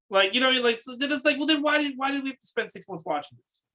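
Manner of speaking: ordinary delivery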